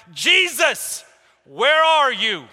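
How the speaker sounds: high, loud, drawn-out call; anguished crying out. Retold or imitated. Preacher voicing a sufferer calling on Jesus, crying out in anguish. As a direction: cry out slowly to heaven from pain. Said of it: "moments of anguish and pain where you're crying out".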